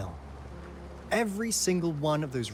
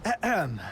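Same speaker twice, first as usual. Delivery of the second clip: pointed little "ahem"; short higher-pitched ahem; throat-clearing; 6 semitones higher, 4 dB louder than usual. Acted. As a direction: clear your throat to regain composure. Clears throat after being interrupted.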